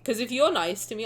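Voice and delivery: ordinary talk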